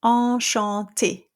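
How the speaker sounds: Enchanté with the open nasal en, no N; French nasal. Shown as a demonstration. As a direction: drop jaw for Enchanté. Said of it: "The first one I have here is".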